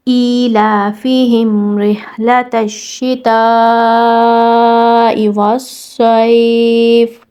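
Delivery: slow, drawn-out Quranic recitation; tajweed recitation. Shown as a demonstration. Teacher modelling the verse for students, slow tajweed recitation. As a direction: chant each letter with full length. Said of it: "مکمل تجوید کے ساتھ".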